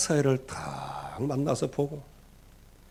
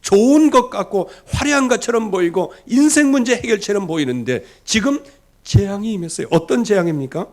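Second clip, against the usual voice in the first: loud, high, rising sermon cry; preaching at full force; 7 semitones higher, 7 dB louder than usual. Acted. Preacher crying out to the congregation about idolatry, loud and high. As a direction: preach it out, pressing hard on every phrase.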